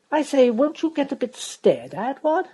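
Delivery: put-on a drawling young toff, high and loud; Freddy voiced. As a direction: lightly play the silly young aristocrat.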